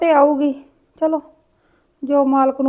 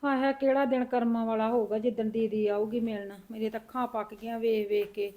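a soft, mournful grandmother's sigh; quiet longing for her daughter, dropping away; sad sigh; 11 dB quieter than usual. Acted. (ਉਦਾਸ ਹੌਲੀ ਹਉਕਾ)

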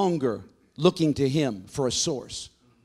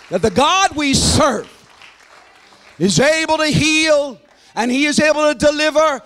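full-force preaching; 9 semitones higher, 8 dB louder than usual. Real, not acted. Preacher telling the church God is able, impassioned full-force preaching. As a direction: ring it out to the whole congregation, stretching key words.

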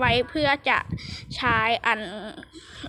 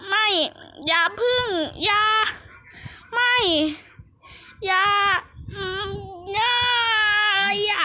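desperate pleading; 6 semitones higher than usual. Pretend. Player begs as the battle slips away, desperate pleading. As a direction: beg it not to happen, word by word.

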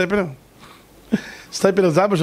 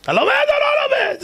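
much higher, fast shout; high demanding shout; faster than usual. Retold or imitated. The rabbi demands the truth from the driver, shouting. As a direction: fire the question at him.

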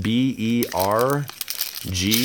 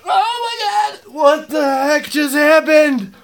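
high, slow squeal of disbelief; shaking with excitement; 3 dB louder, slower than usual. Real, not acted. Card collector stunned by his pull, high excited squeal. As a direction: let the thrill crack the voice way up. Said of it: "I'm shaking a little bit".

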